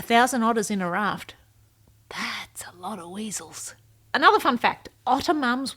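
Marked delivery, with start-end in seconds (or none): 2.1-3.7 breathy whispered joke, much softer; whispered aside. Real, not acted. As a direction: drop to a conspiratorial whisper. Joking aside about otters.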